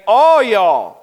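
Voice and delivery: Southern paraphrase. Retold or imitated. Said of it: "Peter here is addressing the church, and he's saying".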